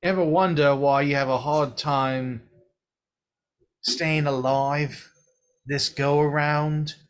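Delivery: put-on blunt, teasing old figure explaining death; Mor'du. Mor'du explaining to the hero that he died, teasing. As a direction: lean in and lecture with cheeky bluntness.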